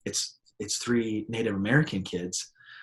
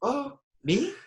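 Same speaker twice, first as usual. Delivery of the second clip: much higher surprised squeak; mock startled. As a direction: play caught off guard. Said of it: "And I'm like".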